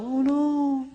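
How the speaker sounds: very high, wailing "Oh no"; Mister Bill. Retold or imitated. The soul pushed aside, wailing helplessly. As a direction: wail it like a squashed clay puppet. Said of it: "like Mister Bill".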